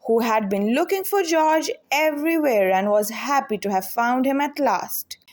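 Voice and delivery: usual speaking voice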